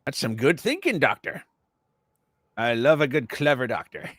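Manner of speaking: put-on character voice, approving scholar; Doctor Sprack. Doctor complimenting another doctor's guess, pompous character voice. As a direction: praise a colleague as the self-important doctor.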